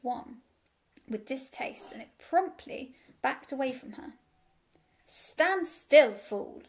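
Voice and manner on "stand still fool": loud, sharp bark of a command; curt, scornful sheepdog order. A narrator's reading. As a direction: bark an order, then drop on the insult. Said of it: "she barked".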